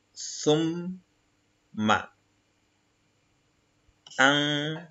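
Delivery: each Arabic syllable said apart, slowly; syllable reading. Shown as a demonstration. Teacher reading Arabic syllables to the class, slow and separated. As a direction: spell the word out syllable by syllable. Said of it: "Donc, ici, on a".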